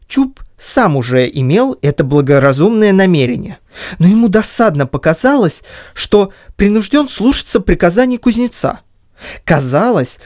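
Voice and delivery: usual speaking voice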